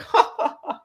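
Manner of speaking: high-pitched imitated laugh; imitated dad's laugh. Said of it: "He's just like".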